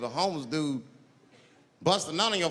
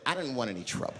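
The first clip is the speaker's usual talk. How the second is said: ordinary talk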